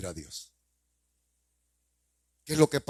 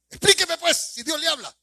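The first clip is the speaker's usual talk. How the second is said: pressing demand; 13 semitones higher, 4 dB louder than usual. Real, not acted. Preacher demands explanation.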